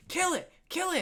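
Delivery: put-on very high, quick child's chant; high-pitched, bloodthirsty kid cheer; egging-on kid. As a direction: squeal it like a kid urging on a friend. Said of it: "the first kid's like".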